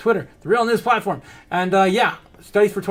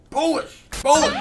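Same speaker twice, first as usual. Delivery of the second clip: excited hype; 10 semitones higher, 3 dB louder than usual. Acted. Hyping crypto news.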